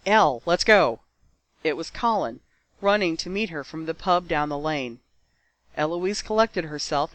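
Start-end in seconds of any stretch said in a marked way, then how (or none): 0.1-1.0 louder call to a friend. Acted. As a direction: call her to come along. Colin calling her over.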